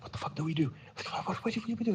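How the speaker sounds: high, hushed mock panic; panicked whisper-down, acted out; imitated scared friend. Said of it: "my friend was scared shitless".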